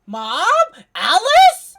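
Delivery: sudden loud high call of recognition; surprised shout. Real, not acted. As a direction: yell the names in shock. Streamer recognizes game character.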